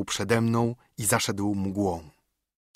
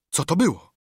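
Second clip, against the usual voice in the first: higher, alarmed demand; urgent question; 5 semitones higher, 3 dB louder than usual. A narrator's reading. Tom asks Silver about the scream, urgent and higher. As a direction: press for an answer, pitch jumping up.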